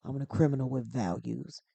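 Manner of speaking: deepened tough-guy movie voice; low register, deliberate, spaced-out delivery; Dominic Toretto. Acted. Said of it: "I can get deeper".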